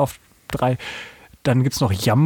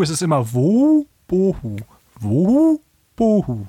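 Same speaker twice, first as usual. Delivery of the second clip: higher wuhu then falling bohu; correct owl hoot; 3 semitones higher than usual. Shown as a demonstration. Correcting cohost's hoot, higher two-part owl call. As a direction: show him the right two-part hoot. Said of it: "nicht wuhu".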